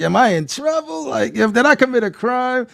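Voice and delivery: a hint of a worried professor; lightly voiced nervous asking; nervous scientist. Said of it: "She's like".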